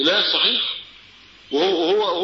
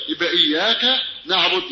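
usual speaking voice

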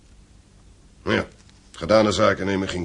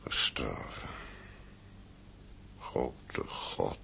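stunned whisper, much lower and softer; shocked whisper; 8 semitones lower, 12 dB quieter, much slower than usual. Acted. Father hearing of his son's death, stunned whisper. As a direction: let the news knock the voice out of you.

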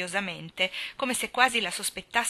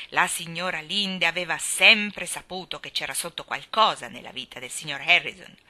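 ordinary delivery, nothing marked

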